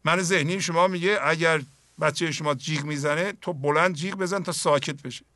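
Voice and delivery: ordinary delivery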